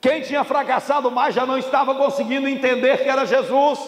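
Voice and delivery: high, projected pulpit delivery; impassioned preaching. Real, not acted. Preacher warns congregation about failure, impassioned preaching. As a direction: preach it out with full conviction.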